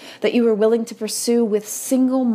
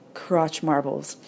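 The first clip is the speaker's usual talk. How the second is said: ordinary talk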